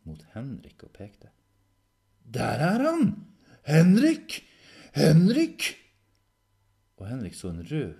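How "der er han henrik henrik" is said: high, very loud alarm call. Acted. Red dog pointing at Henrik, shouting his name.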